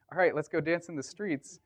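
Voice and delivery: retold rallying call. Teammate urging the group to dance, eager rallying. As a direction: imitate a friend rallying everyone.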